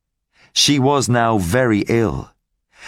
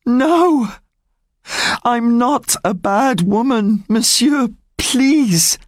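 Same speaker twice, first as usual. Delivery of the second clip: desperate pleading woman; 13 semitones higher than usual. Acted. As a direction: beg for mercy, high and urgent. Fantine begs Javert for mercy.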